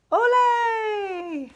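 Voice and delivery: high loud ole; cheer. Acted. Puddle cheering as he jumps in the pond. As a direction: cheer it like a matador. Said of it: "he cheered".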